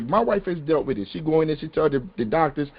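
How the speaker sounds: ordinary talk, nothing marked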